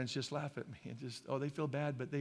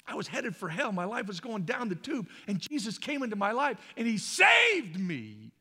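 higher, louder, building to a long shouted "saved"; excited testimony; 5 semitones higher, 8 dB louder than usual. Acted. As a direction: testify with rising fervour, bursting out at the end. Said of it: "happiness and excitement to be alive".

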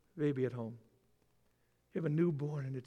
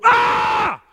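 high loud yelp of pain; 16 dB louder than usual. Retold or imitated. Person with eyelash in the pupil, yelping. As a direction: yelp as if something hit your eye.

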